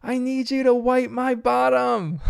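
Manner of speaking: put-on high, drawn-out child's call; mock yell. Bingo calls so all neighbors hear, mock yelling. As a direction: imitate a kid yelling, volume held back. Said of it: "yells at the top of her lungs".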